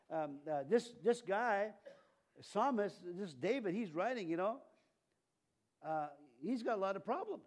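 ordinary delivery

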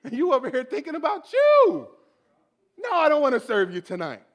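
loud, mock-outraged complaint; indignant outburst. Believer scolding God, indignant. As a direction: holler it at God, comically put out.

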